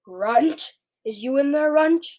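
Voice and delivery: loud, rising giant's call; thunderous shout. A narrator's reading. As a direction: bellow it like a giant hunting someone. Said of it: "a voice like thunder shouted".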